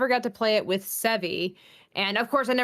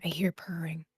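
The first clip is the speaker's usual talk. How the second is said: whisper; 4 semitones lower, 7 dB quieter than usual. Pretend. Teasing joke, then just kidding.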